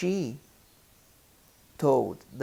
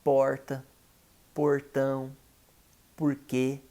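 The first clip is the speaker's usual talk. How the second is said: Campo Grande Portuguese, strong English-like R in porta, portão, porquê; Campo Grande accent. Shown as a demonstration. Teacher demonstrates his own regional Portuguese, Campo Grande accent. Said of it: "I speak".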